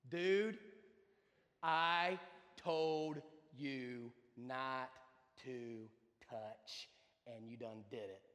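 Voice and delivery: very slow, low, each word drawn out; deadpan scolding. Retold or imitated. Mother lets him cry it out, slow deadpan scolding.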